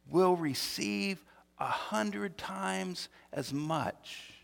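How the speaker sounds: drawn out slowly, each word weighed; preacher slows to stress the promise; slow emphasis. Shown as a demonstration. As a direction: let every word land slowly. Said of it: "do you hear that?".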